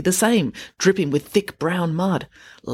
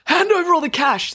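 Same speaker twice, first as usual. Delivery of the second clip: high, forceful robber's yell; 12 semitones higher, 4 dB louder than usual. A narrator's reading. Robber to the first teller, yelling. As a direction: demand it at a shout. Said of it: "the robber yelled".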